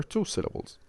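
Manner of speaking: ordinary talk, nothing marked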